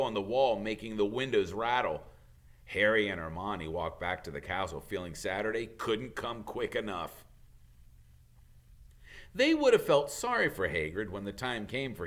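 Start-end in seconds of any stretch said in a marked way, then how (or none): none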